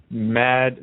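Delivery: ordinary talk, nothing marked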